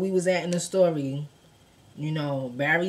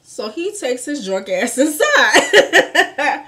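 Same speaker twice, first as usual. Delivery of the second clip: laughing loudly through the line, slow; high, slow, loud laughing retelling; 8 semitones higher, 9 dB louder, much slower than usual. Real, not acted. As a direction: crack up while saying it.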